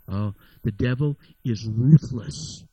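normal talking voice

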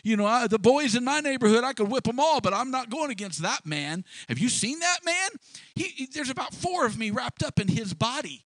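high, nervous backing-out voice; scared soldier. Retold or imitated. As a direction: squeak out excuses like a frightened soldier. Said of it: "They were all afraid".

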